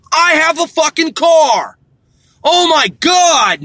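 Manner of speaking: high, loud, slow exasperated shouting; real angry rant. Real, not acted. Driver yells at pedestrian who won't cross, slow and heated. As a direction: hammer each word out in frustration.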